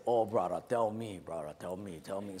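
put-on low, mellow Hawaiian pidgin accent; Hawaiian guy. Condemned man asking the sage's advice, low Hawaiian accent. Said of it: "the Hawaiian guy say".

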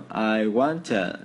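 very slow, words pulled apart. Shown as a demonstration. Teacher slowing a phrase down for students. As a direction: read each word slowly. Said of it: "Nghe lại từng chậm một từ nhá".

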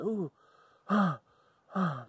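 breathy ooh and ah panting sighs; catching breath. Acted. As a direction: gasp for breath after the chase. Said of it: "trying to catch his breath".

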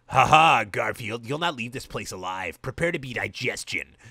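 hammed-up evil monster voice; a hint of garbled sinister creatures; sinister husks. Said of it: "garbled the sinister husks with evil intentions".

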